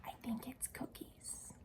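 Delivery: soft secret whisper; a hushed guess shared with the group. Real, not acted. Hushed aside to children.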